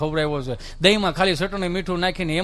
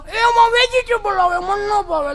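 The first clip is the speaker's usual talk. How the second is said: put-on whiny child refusal; 11 semitones higher than usual. Spoiled son refusing his mother's Maggi, high slow whine.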